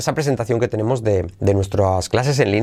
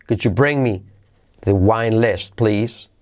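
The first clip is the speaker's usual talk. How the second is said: ordinary talk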